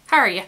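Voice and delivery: "How are you?" slurred to "hah-ree-ya", with no W and the Y merged; Irish "how are you". Shown as a demonstration. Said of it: "H A R Y A".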